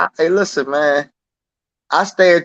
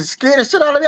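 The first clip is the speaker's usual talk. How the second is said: ordinary talk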